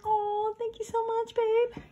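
playfully flattered coo back to her husband; sweet high thank-you. Retold or imitated. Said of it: "I was like".

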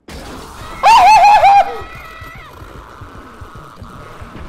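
long, loud, very high squealing scream; high panicked shriek. Real, not acted. Player suddenly chased in the game, shrieking.

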